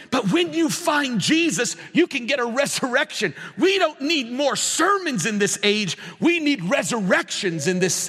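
high-pitched, pressing sermon delivery; impassioned preaching. Real, not acted. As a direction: drive the message home with fervor. Preaches at full force.

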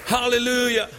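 impassioned revival preacher pleading with listeners; preaching at full force. Acted. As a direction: build the altar call with fervour. Sustained altar call preaching.

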